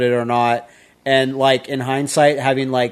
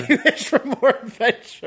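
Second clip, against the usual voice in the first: laughing; 9 semitones higher than usual. Real, not acted. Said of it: "Even I fucking know that".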